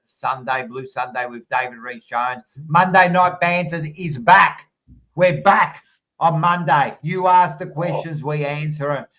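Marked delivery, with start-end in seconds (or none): 2.7-9.0 hyped announcement. Real, not acted. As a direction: sell the show to the chat. Hyping upcoming show.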